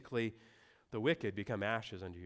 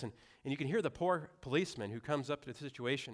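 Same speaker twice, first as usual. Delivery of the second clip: usual speaking voice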